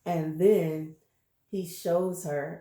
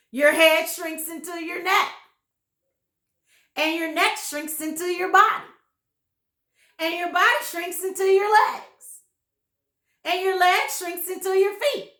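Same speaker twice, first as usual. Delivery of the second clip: higher, louder gloating menace; scaring husband; 9 semitones higher, 7 dB louder than usual. Acted. Husband frightening wife with shrinks, gloating higher. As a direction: relish each horrible step.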